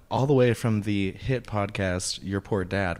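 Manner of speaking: ordinary delivery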